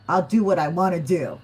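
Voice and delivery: bold, defiant mock voice; playful defiant tiger; sassy tiger. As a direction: toss it off like a cat that won't be told. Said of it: "The tiger energy is like".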